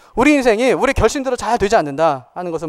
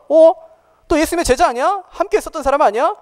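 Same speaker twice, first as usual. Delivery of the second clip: lightly acted, higher-pitched accusing question; a retold challenge, pitched up in suspicion; servant girl questioning; 8 semitones higher, 3 dB louder than usual. Servant at the gate challenging Peter, mildly accusing. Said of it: "문을 지키는 여종이 뭐라고 하냐면".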